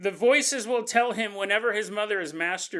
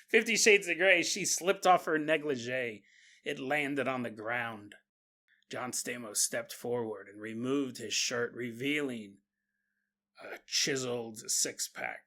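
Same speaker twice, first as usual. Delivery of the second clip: sultry narrator voice; 8 semitones lower, 5 dB quieter than usual. Pretend. Demon voice reading erotic narrative.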